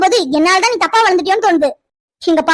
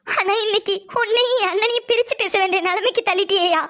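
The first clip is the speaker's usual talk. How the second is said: softer, weeping words to her nephew; tearful lament; 7 dB quieter than usual. Acted. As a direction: let it break into quiet crying. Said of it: "தனியா அழுதுட்டு இருக்கா". (கண்ணீருடன் மெதுவாக, அழுகை குரலில்)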